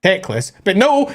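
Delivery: normal talking voice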